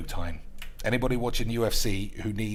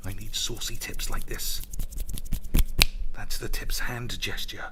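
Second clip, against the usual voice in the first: whisper. Acted. Conspiratorial aside to chat.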